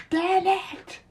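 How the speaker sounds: high, loud anguished shout; frustrated yell. Acted. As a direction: yell it out in defeated fury. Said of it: "As he yelled".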